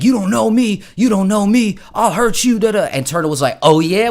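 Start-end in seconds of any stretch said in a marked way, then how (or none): 0.0-2.9 high, loud mock tough-guy threat. Dude threatening Turtle, high loud mock bravado. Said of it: "And the dude was like". 3.6-4.1 amused challenge. Retold or imitated. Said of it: "with a big smile".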